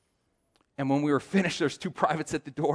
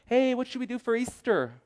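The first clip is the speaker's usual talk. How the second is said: high-pitched imitation of a wife; mock female voice, pitched way up; woman's voice; 11 semitones higher than usual. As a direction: put on a much higher voice as your wife. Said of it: "That's a woman sound to me".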